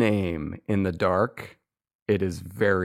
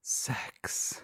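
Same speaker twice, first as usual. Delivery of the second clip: hushed single word; whisper; 12 dB quieter than usual. Shown as a demonstration. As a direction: whisper it very softly. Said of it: "my five whispered words".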